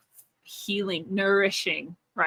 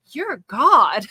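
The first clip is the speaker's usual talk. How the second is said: louder disbelieving You're God; asking God in a field if he's really God, astonished and louder; surprised question; 3 semitones higher, 7 dB louder than usual. Retold or imitated. Repeating God's answer back in surprised disbelief.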